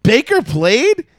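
high, slowing stunned double take; stunned disbelief. Retold or imitated. Realizing the comeback.

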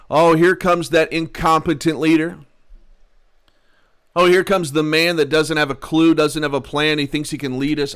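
loud, high taunting crowd; mocking jeer. Mocking crowd jeers at Moses as he walks, loud taunt. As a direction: jeer at him like a scornful mob.

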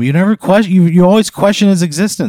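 loud, high-pitched accusation; indignant scolding. Acted. Host defending the deer to the guest, indignant.

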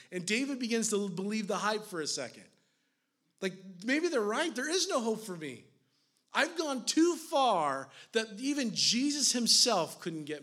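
loud, high, driving sermon voice; impassioned preacher pleading for a drowning king; preaching at full force. Acted. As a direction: preach it hard, pressing every line.

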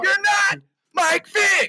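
high, drawn-out taunting yell; heckling shout. Real, not acted. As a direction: holler it slowly at a buddy. Mock quarrel over passing.

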